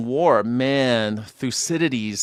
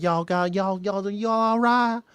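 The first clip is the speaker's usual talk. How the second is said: hammed-up friendly Southern twang; Southern accent; 12 semitones higher than usual. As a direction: gush Southern hospitality at a stranger. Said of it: "like, Southern hospitality".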